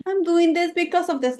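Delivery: high mocking sing-song of the excuse. Dismissing women's excuses, mocking high voice. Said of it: "eradicate that bullshit of".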